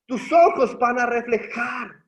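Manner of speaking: más fuerte, con convicción creciente; proclámalo con esperanza; predicación enfática. Acted. (predicador que alza la voz con fuerza)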